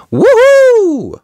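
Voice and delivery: very high, loud whoop; cheering. Acted. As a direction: whoop in celebration, pitch soaring. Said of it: "the team cheered".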